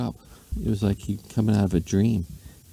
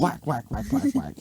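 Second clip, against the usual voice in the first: whacking sounds; 10 semitones higher than usual. A narrator's reading. Swatting the elephant.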